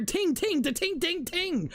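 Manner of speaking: high mocking ting-ting chime noises; annoyed mimicry of the chimes; imitating game chimes. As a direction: mimic the game's pinging sounds, pitched high. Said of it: "'cause you hear".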